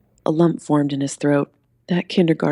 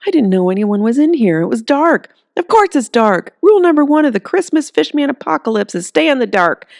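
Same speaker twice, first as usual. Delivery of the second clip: higher, louder exasperated scolding; scolding woman; 10 semitones higher, 5 dB louder than usual. Acted. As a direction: tell him off like he's a fool. Woman scolds Earl in dark.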